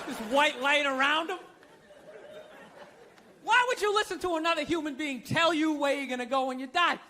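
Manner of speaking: ordinary talk, nothing marked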